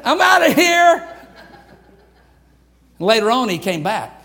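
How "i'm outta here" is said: higher and louder, a quick panicked exit; bailing out. Retold or imitated. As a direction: throw it off like you're bolting for the door. John Mark quits, voiced.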